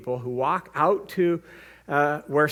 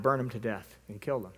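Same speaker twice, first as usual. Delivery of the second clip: normal talking voice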